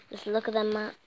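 ordinary delivery

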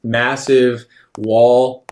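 ordinary talk

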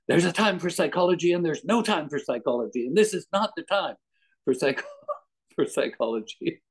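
lightly mock a panicked protest; panicking sister. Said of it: "she was just panicking".

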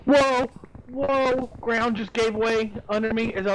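startled. Real, not acted. Ground caves in underfoot.

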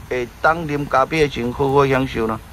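ordinary delivery

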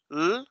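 L held as a sustained "lll"; teacher's lone L sound; isolated L. Shown as a demonstration. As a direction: hum out L with the tongue in place. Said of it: "este sonido".

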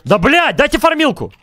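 высоко и настойчиво; требует у команды; раздражённое требование. Real, not acted. (раздражённо)

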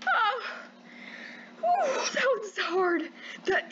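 very high, gleeful victory squeals; thrilled high-pitched celebration; triumphant squealing. Real, not acted. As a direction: squeal with joy at winning. Player just beat the shark.

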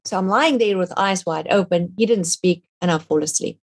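usual speaking voice

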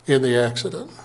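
normal talking voice

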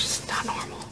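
barely voiced, very soft whisper; hushed, overwhelmed aside; stunned whisper. Real, not acted. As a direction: whisper it to yourself in disbelief. Stunned, hands shaking over the book.

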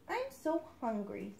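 soft little duckling; small hungry duckling, quiet; little duckling complaining softly. Acted. Duckling voiced in story.

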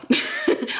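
a quick giggle breaking out high; giggling at the thought of camp; high breathy laugh. Real, not acted. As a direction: laugh it off, amused.

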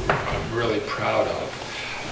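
normal talking voice

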